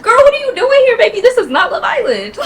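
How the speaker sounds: a loud, high sassy shriek at a newcomer bringing drama; mock outraged shout. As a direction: shriek it like a shocked diva. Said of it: "I was just like".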